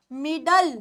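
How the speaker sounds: मिडल with a clear dark ल at the end; मिडल, the ले sounding only as ल; मिडल, no vowel in the final ल; say middle. Shown as a demonstration. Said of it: "एल ई की sound होती है ल।".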